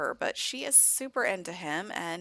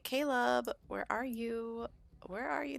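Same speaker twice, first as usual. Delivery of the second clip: higher, softer sing-out for a brother; lightly voiced little search call; 5 semitones higher, 5 dB quieter than usual. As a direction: call out for him, high and soft. Voicing sister searching for her brother.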